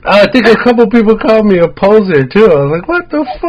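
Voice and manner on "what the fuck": very high incredulous retort; indignant disbelief. Retold or imitated. Retelling being called a poser at the show, indignant disbelief. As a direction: balk at the accusation, pitch shooting up.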